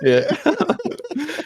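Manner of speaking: high-pitched laughing yeah; giggling through a yes. Real, not acted. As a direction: laugh the word out. Joking about a mishap.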